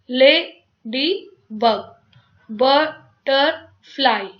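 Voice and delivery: slow "लेडी बग" and "बटरफ्लाई", each in three separate beats; split into syllables. Shown as a demonstration. Teacher showing three syllables, slowly splitting "लेडी बग" and "बटरफ्लाई". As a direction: gaps inside "लेडी बग" as ले, डी, बग and "बटरफ्लाई" as ब, टर, फ्लाई. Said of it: "three syllable हो गया".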